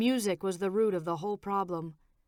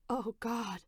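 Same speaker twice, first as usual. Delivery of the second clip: voice cracking; 3 semitones higher, 5 dB quieter than usual. A narrator's reading. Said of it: "Her voice cracked".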